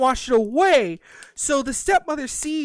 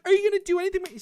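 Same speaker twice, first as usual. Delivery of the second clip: high voiced question; 11 semitones higher, much faster than usual. Ash asks, voiced higher.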